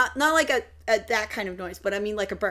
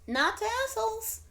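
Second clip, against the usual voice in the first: much higher bird-character voice; [name]'s bird voice; 11 semitones higher, 4 dB quieter than usual. Acted. [name] answering Marcus's tease about speaking bird, high creature voice. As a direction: squeak out a pert retort in a high little voice. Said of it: "And [name]'s just like".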